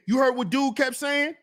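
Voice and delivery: usual speaking voice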